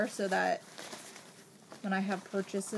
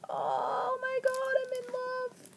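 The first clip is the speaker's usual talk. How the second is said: delighted squeal; 14 semitones higher, 3 dB quieter than usual. Real, not acted. Gushing over a friend's stickers, very high swooning squeal. Seeing bow and heart stickers.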